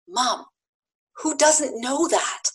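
slow, pointed disbelief, lightly voiced; imitated grown daughter. Daughter answering her mother's new insight, imitated disbelief. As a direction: play a daughter gently schooling mom. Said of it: "twenty-two or so year old at the time said".

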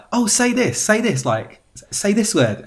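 high-pitched excited pleading; mock eager fans. Americans asking Brit to say a word, mock eagerness. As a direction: imitate someone begging for a party trick. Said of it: "they're just like".

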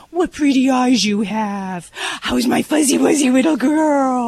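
put-on slow, loud cooing baby talk; baby talk praise. Shown as a demonstration. Vet demonstrating gushing baby talk to a little dog. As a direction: gush over the pet's pretty eyes. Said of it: "you use it and go with it".

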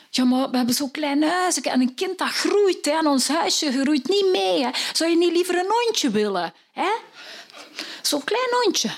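high, wheedling voice; husband coaxing his wife to take a dog instead. Acted. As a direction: sweet-talk her toward a puppy.

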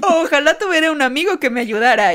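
hammed-up TV ad voice; over eager infomercial. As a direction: wish aloud like an infomercial. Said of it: "como actuando como infomercial".

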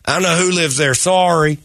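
high hillbilly twang; put-on hillbilly accent. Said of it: "why did I use hillbilly voice?".

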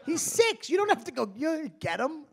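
indignant retort. Retold or imitated. Retorted family quarrel.

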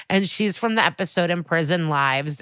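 ordinary talk

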